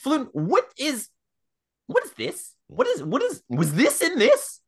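very high, stammering questioning; Arnold questioning. Acted. Arnold asks friend about the powder, high alarmed questioning. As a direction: pitch it way up, halting over each question. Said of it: "while I'm doing it, just be like".

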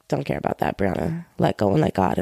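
low, whispered calming inner voice; inner Sagittarius voice. Retold or imitated. Her inner Sagittarius telling her to let go, low and hushed. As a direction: soothe yourself in a low hush. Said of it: "Sagittarius in me... is like".

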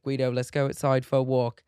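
put-on loud British accent. Host alone talking to the dog, British accent. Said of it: "in a British accent".

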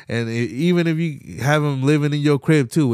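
ordinary talk, nothing marked

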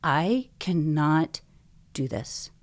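emphatic, loud bursts of pleading; retold desperate plea. Frightened patient begging God for help at night, retold desperate plea. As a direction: re-enact a desperate prayer, hammering each refusal. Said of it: "I was shaking".

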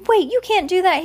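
high, pleading protest; little boy's alarmed objection; high storybook cry. A narrator's reading. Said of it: "he cried".

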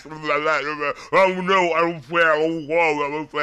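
garbled imitation of a rough demo; mumble-rap the track mockingly; lightly mocked muddy vocals; mimicking bad recording.

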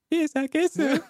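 tiny, very high falsetto; comically high-pitched impression of a wife; Priscilla. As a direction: squeak it out as a mock girlish voice. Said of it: "That's my impression of her voice".

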